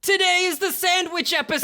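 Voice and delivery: high-pitched, hyped-up episode reveal; excited announcement. Pretend. Host revealing the episode topic to cohost, excited announcement.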